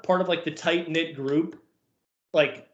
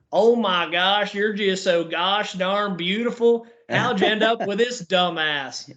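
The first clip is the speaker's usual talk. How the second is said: put-on slow, drawn-out country accent, pitched up; mock country accent, slow and lifted; hickish country accent; 4 semitones higher, slower than usual. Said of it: "he never used to sound, like, hickish at all with a country accent".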